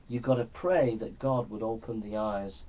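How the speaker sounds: ordinary delivery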